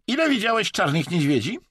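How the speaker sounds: high, insistent question; pressing. A narrator's reading. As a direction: press him for an answer. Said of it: "naciskał Chińczyk". (natarczywe, wysokie pytanie)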